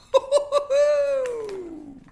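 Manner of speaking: high, loud drawn-out laugh. Real, not acted. Reader amused by own great idea, laughing.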